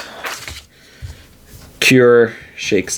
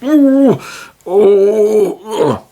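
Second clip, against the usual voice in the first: high moaning groans, a little louder; sick orc groans; 11 semitones higher, 3 dB louder, much slower than usual. Acted. Nauseated orc groaning inside the hut. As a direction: groan like a queasy creature. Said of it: "So the orc goes".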